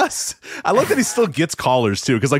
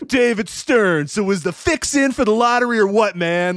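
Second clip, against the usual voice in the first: higher, lightly voiced riled-up host; hammed-up radio host pressing; mock pissed Rome; 9 semitones higher than usual. Rome grilling the NBA commissioner, mock riled-up host voice. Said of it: "he's pissed".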